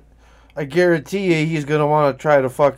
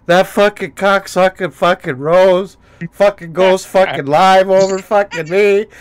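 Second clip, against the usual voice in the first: louder mock rant, higher up; mock furious rant; 5 semitones higher, 5 dB louder than usual. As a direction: rant like a sore loser streamer. Imitating Tony's rant.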